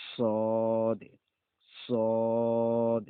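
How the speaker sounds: সদ loud and heavy, full mouth; teacher stresses emphatic সদ; heavy sad. Shown as a demonstration. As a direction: boom out a thick সদ. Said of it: "তারপরে দেখেন".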